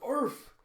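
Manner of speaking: high yappy bark imitation; dog bark.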